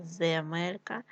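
usual speaking voice

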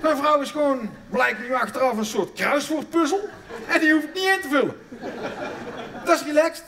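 delighted discovery. Real, not acted. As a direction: revel in the discovery.

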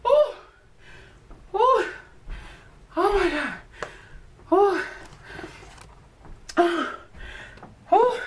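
high-pitched "ooh" cries after a hard workout; exhausted exertion whoops. Real, not acted. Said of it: "my breath".

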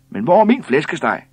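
loud, high-pitched angry question; indignant demand. A narrator's reading. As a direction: demand your missing order like a scolding wife. Same scolding woman demands her roast.

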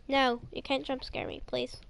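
ordinary delivery

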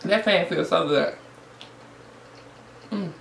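words muffled by chewing; talking while chewing. Real, not acted. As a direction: mouth full of food. Seen 1: Bites taco at 1.1–1.3, then chews with cheeks full.

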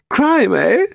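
put-on high, scornful old lady; derisive old woman. A narrator's reading. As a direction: scoff like a haughty dowager. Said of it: "She laughed derisively".

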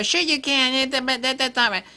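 high, whiny mock naysayer; mock objector. Mocking an objector.

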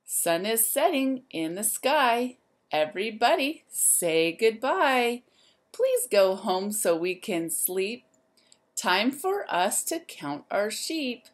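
lively, sing-song storybook voice; reading to children. A narrator's reading. Teacher reading a rhyme book to the class, lively and sing-song. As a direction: wake the zoo up like a bright morning call.

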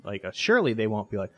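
ordinary talk

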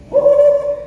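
high, loud hooting cry; preacher's whoop. Real, not acted. Preacher reacts to the horror of hell, high loud whoop. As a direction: let out a loud high "woo".

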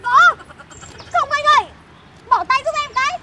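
high, loud flustered protest; indignant protest. Acted. Woman fighting off the motorbike driver, loud and flustered. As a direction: push his hands off in alarm.

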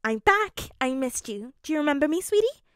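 high, loud, gushing excitement; excited greeting. A narrator's reading. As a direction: gush excitedly at a friend you missed. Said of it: "Ashido said excitedly".

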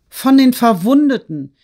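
high, loud exclamation; impassioned outcry. A narrator's reading. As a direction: throw the answer out, high and forceful. Daughter answers in outrage.

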